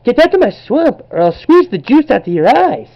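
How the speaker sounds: high, loud hammed-up ogre threat; bad Shrek voice. Kid's amateur Shrek impression for viewers, squealed high. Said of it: "if that was a bad Shrek voice".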